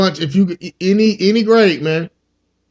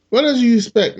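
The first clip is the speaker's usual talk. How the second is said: ordinary talk, nothing marked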